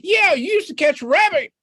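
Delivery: high, pleading little rabbit voice; begging in a high squeaky voice; Rabbit's voice. Acted. Rabbit begs Fox for a fish, high and pleading.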